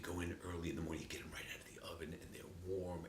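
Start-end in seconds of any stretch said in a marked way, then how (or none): 1.0-3.0 hushed. Real, not acted. Savoring whisper.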